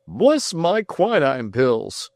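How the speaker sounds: put-on slow, drawn-out old gentleman's exclamation; a fussy old man's shocked oath; startled cry. A narrator's reading. Mister Damon reacting to Tom's note, slow startled cry. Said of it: "cried Mister Damon".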